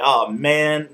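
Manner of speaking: mock complaining. Rollins complaining to Kane, mock complaint. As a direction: grumble in imitation of a fed-up wrestler. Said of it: "Seth Rollins is complaining".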